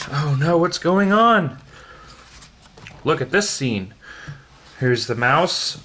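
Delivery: plain reading voice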